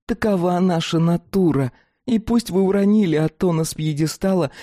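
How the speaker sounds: high, uneasy creature voice; dog-woman voice. Acted. Fatida musing, worried.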